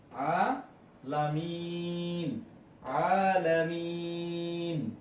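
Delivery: আলামিন with the final মিন held very long; three-alif elongation. Shown as a demonstration. Teacher showing students the pause at verse end, আলামিন drawn out long. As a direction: stretch the মিন of আলামিন on one steady note. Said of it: "তিন আলিফ টানিয়ে পড়তে হবে".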